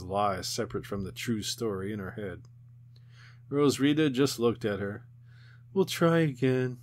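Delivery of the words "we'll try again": ordinary delivery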